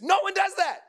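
high, loud burst of disbelief; emphatic, high-pitched exclamation; incredulous outburst. Real, not acted. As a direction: cry it out as if it's absurd. Preacher scoffing at absurd classroom scene.

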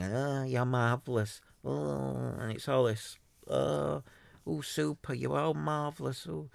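high, slow, gushing mock flattery; mock brown-noser. Mocking Clubhouse flatterers gushing over a podcast, gushing mock praise. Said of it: "so everyone's brown nosing".

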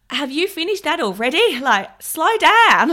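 high, loud mock Australian farmer voice, laughing through it; hammed-up Australian accent with giggles; bad Australian accent. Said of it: "really bad Australian accent impression coming up".

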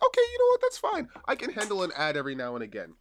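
high exaggerated "okay fine"; mock resigned. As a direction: act out grudging acceptance. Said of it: "I'm like".

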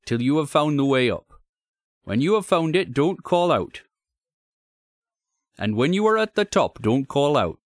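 high, loud rustic warning; urgent, deferential working man; troubled signalman. Acted. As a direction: warn him earnestly in a country signalman's voice. Signalman warns visitor.

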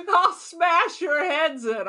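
hammed-up old lady threatening kids; mock yell. Mock old woman yelling at children. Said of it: "what she yelled at the children".

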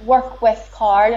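ordinary delivery

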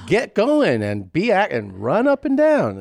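loud, high, drawn-out fitness cheer; mock exercise commercial. Exercise ad urging an elderly woman, mock peppy shout. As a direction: belt out an over-the-top workout slogan. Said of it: "you're seeing shit like".

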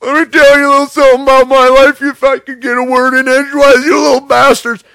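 frantic over-the-top shouting tirade, voice cranked way up; Chris Farley voice. Retold or imitated. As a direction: rant it like an unhinged hyped-up motivational speaker. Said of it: "the character has taken on this whole Chris Farley inflection".

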